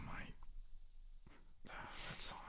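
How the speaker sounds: breathy whispered sigh over the song. Real, not acted. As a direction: exhale it in a whisper, fed up.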